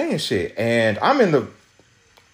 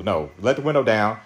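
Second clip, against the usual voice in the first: ordinary talk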